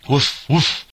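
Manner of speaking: high, loud mock dog bark. A narrator's reading. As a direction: bark it out like a big sheepdog. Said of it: "was the eloquent response of the great sheepdog".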